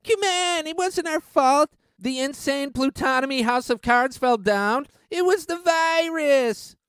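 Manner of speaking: high, whiny put-on excuse voice; mock plutocrat. Vlogger mocks plutocrats blaming the virus in a high whiny voice.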